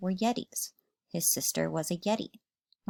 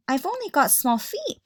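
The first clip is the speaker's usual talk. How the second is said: higher, little George voice; small child voice; 8 semitones higher, 3 dB louder than usual. Acted. George to his big sister, high small voice. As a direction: sound small and pleading.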